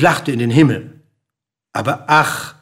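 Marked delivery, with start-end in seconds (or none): none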